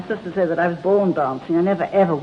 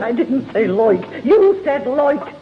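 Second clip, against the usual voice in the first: indignant retort; 5 semitones higher, 3 dB louder, much slower than usual. Retold or imitated. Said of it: "I said".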